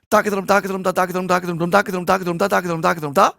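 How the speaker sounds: very rapid, running konnakol chant; tongue-twisting quick rhythmic recitation. Shown as a demonstration. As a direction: race through the syllables without a break. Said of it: "a little bit faster".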